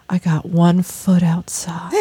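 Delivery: slow breathless whisper. Retold or imitated. Caught child, whispered quote.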